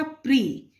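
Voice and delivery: प्री said as "pree"; correct i-ending pronunciation. Shown as a demonstration. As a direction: end प्री on a long "ee". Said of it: "क्या बोला जाता है?".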